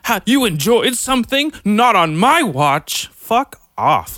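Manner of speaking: mock snob. Mocking friends who scorn enjoyment, high sneering snob. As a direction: ham up a condescending gatekeeper. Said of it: "their fucking snob vein pops out".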